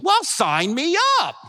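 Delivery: mock eager sign-up; sarcastic.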